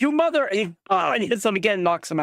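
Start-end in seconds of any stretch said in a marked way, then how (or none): 0.0-0.4 curse. Acted. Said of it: "the guy on the floor is like".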